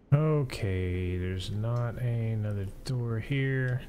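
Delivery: usual speaking voice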